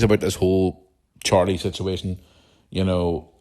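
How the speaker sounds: usual speaking voice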